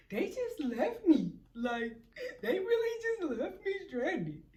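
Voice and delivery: high, whiny retold wail; mock crying. Crybaby calls her mom about being left, mock crying. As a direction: imitate a crybaby complaining to be rescued. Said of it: "I'm crying".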